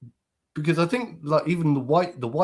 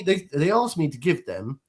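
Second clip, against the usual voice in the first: usual speaking voice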